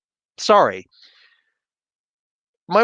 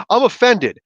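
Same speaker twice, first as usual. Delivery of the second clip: mock offended; 7 semitones higher, 5 dB louder than usual. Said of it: "turn around and is like".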